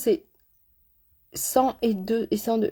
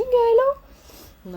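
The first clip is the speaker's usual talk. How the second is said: mock hello; 13 semitones higher, 4 dB louder, much slower than usual. Mocking exaggerated brows.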